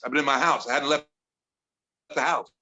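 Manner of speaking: ordinary talk, nothing marked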